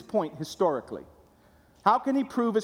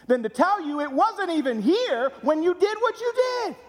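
preaching at full force; 8 semitones higher than usual. Acted. Preacher exhorting the congregation, at full force. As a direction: build to a fervent, full-voiced peak.

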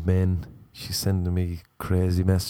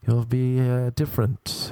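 ordinary talk, nothing marked

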